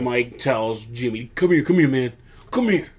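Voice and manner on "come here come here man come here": high, urgent mock summons; beckoning call. Wrestler on the mic calling his brother over, beckoning. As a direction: call him over, pitched up.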